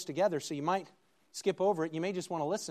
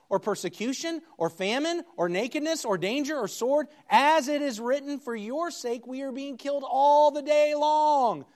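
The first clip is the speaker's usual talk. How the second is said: fervent reading that builds louder and higher; scripture reading; 3 semitones higher, 5 dB louder than usual. Acted. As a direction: read Paul's words as rising proclamation. Preacher reads Romans eight aloud.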